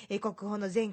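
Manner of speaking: ordinary talk, nothing marked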